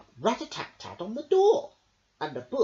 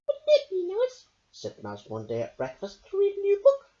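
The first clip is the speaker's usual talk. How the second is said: high, bright little mouse voice; 5 dB louder than usual. Acted. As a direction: play the small mouse with a hopeful idea. Mouse speaks in story.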